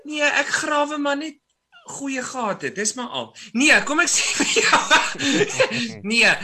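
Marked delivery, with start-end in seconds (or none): 3.5-6.3 slow, louder words broken by a laugh. Real, not acted. Host answering his co-host's compliment, slow and laughing. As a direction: laugh it off while starting to answer.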